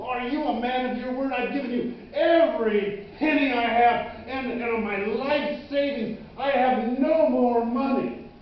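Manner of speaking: loud, high, pleading exasperation; indignant protest. Acted. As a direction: protest that you've given everything. Indignant protest to old man.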